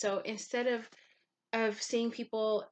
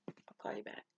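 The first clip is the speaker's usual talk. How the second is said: softer hushed whisper to the phone; whispered aside; 11 dB quieter than usual. Real, not acted. Vlogger whispering to her calling mom, hushed. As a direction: quietly brush off a call while filming. Whispering to caller, camera running.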